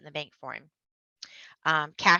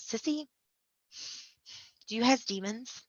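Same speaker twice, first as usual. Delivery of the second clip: lightly voiced small girl, higher; little sister voice; 6 semitones higher, 5 dB quieter than usual. Five-year-old sister asking Sissy about demons, lightly higher. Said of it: "she goes".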